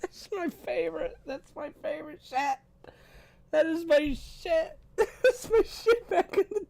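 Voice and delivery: high, squeaky laughing voice; cracking up. Real, not acted. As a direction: squeeze the words out through helpless laughter. Cracking up at clip.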